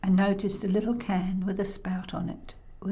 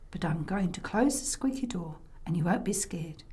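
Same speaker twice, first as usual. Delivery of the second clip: hushed bedtime whisper; 4 semitones lower, 6 dB quieter than usual. Acted. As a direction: whisper it softly to a child.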